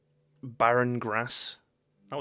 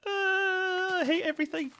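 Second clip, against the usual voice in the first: high-pitched despair. Real, not acted. Streamer fed up after scares, high despairing whine. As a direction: moan it out very high.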